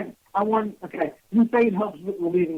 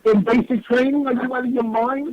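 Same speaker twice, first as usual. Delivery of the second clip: raised, higher-pitched disbelief; disbelieving comeback, pitched up; incredulous; 4 semitones higher than usual. Real, not acted. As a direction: challenge them like they must be crazy. Heated banter, disbelief at co-host.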